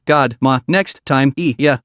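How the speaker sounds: ordinary delivery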